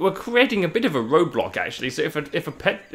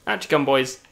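normal talking voice

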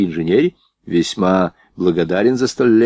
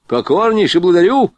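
high, hearty soldier's thanks; exclaimed military thanks; full-voice bellow; 9 semitones higher, 4 dB louder than usual. A narrator's reading. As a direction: belt out thanks in a raised pitch. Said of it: "во весь голос заорал тот".

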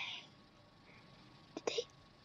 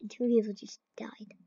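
whisper; 4 semitones lower, 7 dB quieter than usual. Real, not acted. Hushed while hiding.

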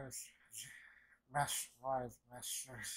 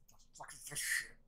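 hushed, breathy quoted curse; whispered disbelief; faster than usual. Retold or imitated. Character whispering in shock at the fight, hushed. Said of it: "That's like".

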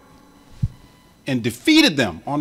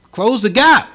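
higher, louder urging; emphatic call; 8 semitones higher, 6 dB louder than usual. Real, not acted. Preacher urging the congregation, loud and high. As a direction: drive the point home to the congregation.

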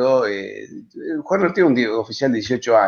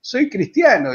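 higher-pitched Andalusian lilt, lightly imitated; a hint of an Andalusian accent, pitched high; 11 semitones higher, 4 dB louder than usual. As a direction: call out like an Andalusian-speaking native. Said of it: "le habla en perfecto tonada andaluza".

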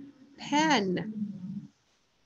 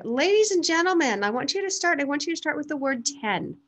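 higher, lifted classroom-teacher voice; teacher addressing class; 5 semitones higher, faster than usual. Acted. Teacher starts the class on word chaining, raised addressing voice. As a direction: announce the task to a roomful of pupils. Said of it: "So we can say".

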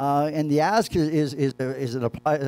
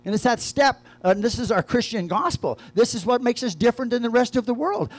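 raised, ringing sermon climax; preaching at full force; 6 semitones higher than usual. Acted. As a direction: proclaim the gospel, rising with conviction.

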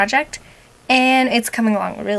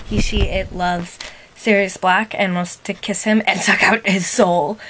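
ordinary talk, nothing marked

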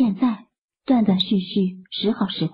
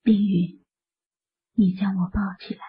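weak whisper. Acted. Sick woman asks Lin Yun to lift her, whispering. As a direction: whisper it, too weak to sit up. 病弱蒙文请求.

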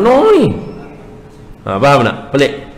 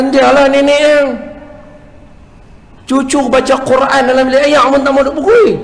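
grandpa protesting; 12 semitones higher than usual. Retold or imitated. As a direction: defend yourself like an annoyed old man. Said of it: "Tok Wan dia kata".

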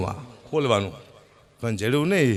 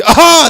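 a big, high, loud hawk of the throat; loud throat-clearing; 13 semitones higher, 13 dB louder, much slower than usual. Retold or imitated. Uncle clears his throat at the car window, loud hawking. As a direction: clear the throat hard like a whistle.

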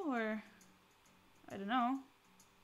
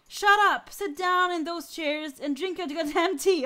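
louder, rude order to sit down; angry snap; 6 dB louder than usual. Acted. Grumpy captain telling the guests to sit, loud and rude. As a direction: cut them off and bark the order.

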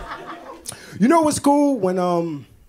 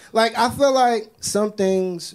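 slow, drawn-out higher musing; 8 semitones higher, slower than usual. Real, not acted. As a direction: take your time, thinking aloud.

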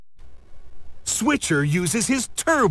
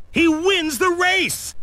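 much higher, bright with victory; triumphant; 11 semitones higher than usual. A narrator's reading. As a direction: cheer the win for the kids. Triumphant finish of the race.

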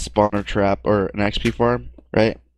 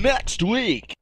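announcer voice; 11 semitones higher, 3 dB quieter than usual. Pretend. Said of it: "Tune in".

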